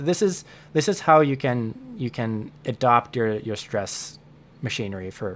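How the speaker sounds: normal talking voice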